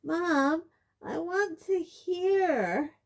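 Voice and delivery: very high, slow, loud wail; girl wailing to her mother. A narrator's reading. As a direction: wail it like a left-out child. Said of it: "wailed Ginny".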